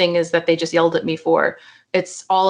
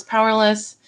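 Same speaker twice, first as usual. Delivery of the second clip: normal talking voice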